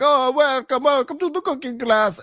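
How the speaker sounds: high, sing-song welcome; cheery host voice. Retold or imitated. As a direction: greet them like an overjoyed host. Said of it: "happiness of".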